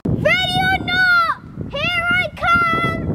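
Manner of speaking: loud, high hide-and-seek call; high, carrying seeker's shout. Real, not acted. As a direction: sing it out to the hiders, drawing out the end.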